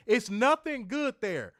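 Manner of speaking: loud, high, slow repeat; emphatic. Shown as a demonstration. Repeats line louder.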